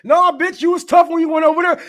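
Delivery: high, loud mocking retort; mock yell. Comic yelling at veterans, high loud mock retort. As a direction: shout them down scornfully.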